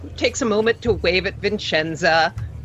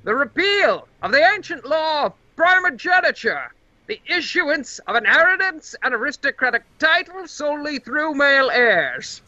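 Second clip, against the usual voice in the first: magically amplified voice; 7 semitones higher than usual. Acted. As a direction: boom it out like an amplified herald. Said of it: "a magically amplified voice of the Senate speaker".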